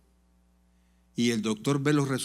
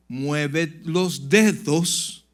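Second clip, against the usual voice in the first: slow instruction; 2 semitones higher, 4 dB louder, much slower than usual. Retold or imitated. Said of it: "cuando el doctor le dijo".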